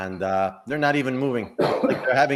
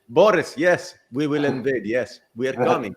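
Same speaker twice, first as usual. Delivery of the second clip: mock Russian accent; 2 semitones higher, 4 dB louder than usual. Retelling a dream of Russia, in a mock Russian accent. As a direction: play a cartoon Russian leader announcing an invasion. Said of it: "I didn't even do the right accent".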